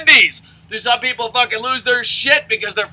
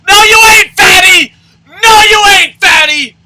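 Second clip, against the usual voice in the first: higher, louder screaming insult; furious screamed taunt; 6 semitones higher, 5 dB louder than usual. Real, not acted. Ranter screaming at wannabe wrestlers, furious taunt. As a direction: scream it at them with full contempt.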